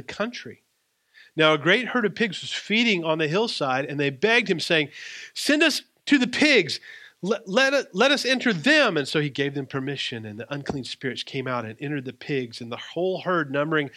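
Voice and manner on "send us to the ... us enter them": high, loud pleading demon voices; begging demons. A narrator's reading. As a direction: beg frantically to be sent into the pigs. Demons beg Jesus.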